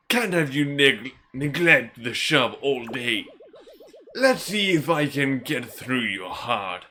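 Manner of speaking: slow, emphatic scolding elder; put-on old man voice. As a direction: rouse a lazy boy as his old mentor. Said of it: "I don't think I can keep doing that voice".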